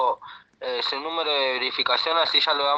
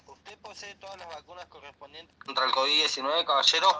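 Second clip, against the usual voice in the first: to someone hard of hearing. Real, not acted. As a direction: raise your voice so they can hear. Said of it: "hable más fuerte porque no le escucho".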